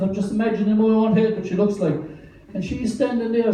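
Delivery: usual speaking voice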